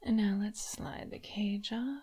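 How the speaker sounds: soft unvoiced whisper. Mistress whispering to her toy, teasing. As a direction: breathe it close to the ear.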